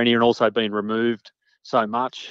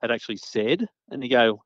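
ordinary delivery

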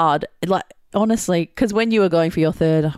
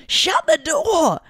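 barked high and slow German villain order; Gestapo voice; 14 semitones higher, slower than usual. Retold or imitated. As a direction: yell it like a movie Gestapo officer. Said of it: "in a Gestapo voice".

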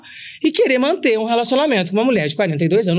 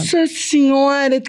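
high, slow swoon of delight; swooning exclamation; 7 semitones higher, slower than usual. Real, not acted. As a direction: melt at the thought of his voice. Swooning over his voice.

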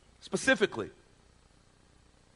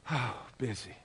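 softer, weary sighed echo; playfully breathless and drained; mock out of breath; 3 semitones lower, 8 dB quieter than usual. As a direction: let out a big sigh, then pretend to be winded. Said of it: "we actually do get out of breath".

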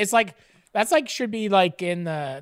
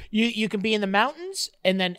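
ordinary talk

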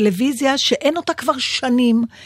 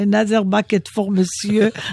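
put-on French accent; much slower than usual. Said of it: "תמיד תעשה את זה במבטא צרפתי".

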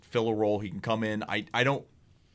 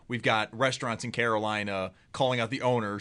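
ordinary delivery

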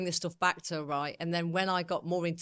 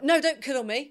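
sharp, pushing-away refusal, higher and louder; defensive refusal; 7 semitones higher, 6 dB louder than usual. Retold or imitated. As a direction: fend off the cuddle with a mock snap. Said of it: "I was like".

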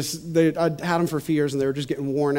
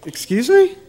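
confused surprise; 9 semitones higher, 6 dB louder than usual. Retold or imitated. Wife confused at nurses' station.

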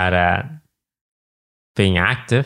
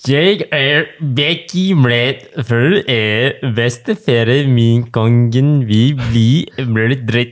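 slow, hammed-up Norwegian accent, a bit louder; best Norwegian voice; 2 semitones higher, 4 dB louder, slower than usual. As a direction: go all in on your best Norwegian accent, slowly. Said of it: "I try to put on my best Norwegian voice".

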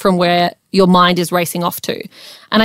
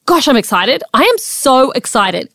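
higher-pitched upbeat self-talk; acted excitement; 5 semitones higher than usual. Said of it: "switch the wording and say".

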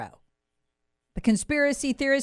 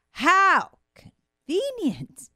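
sarcastic; 6 semitones higher, much slower than usual. Acted. Sarcastic aside on Moderna patent.

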